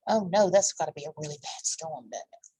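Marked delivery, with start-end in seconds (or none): none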